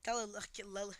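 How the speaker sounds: ordinary talk, nothing marked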